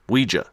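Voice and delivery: "Ouija" as WEE-juh; preferred pronunciation. Shown as a demonstration. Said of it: "I like to call it Ouija".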